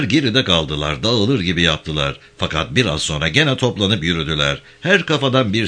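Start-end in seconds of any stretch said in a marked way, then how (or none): none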